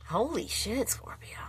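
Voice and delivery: breathless whisper of shock; a real gasped whisper; stunned whisper. Real, not acted. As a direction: whisper it as if you can't believe it. Shocked by the card pull.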